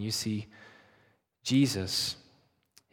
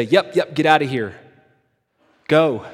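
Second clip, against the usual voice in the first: loud and higher, shooing someone away; hasty loud brush-off; 5 semitones higher, 13 dB louder than usual. Acted. Imagined Jacob telling God to leave, loud hasty brush-off. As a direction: hurry them off, loud and panicky.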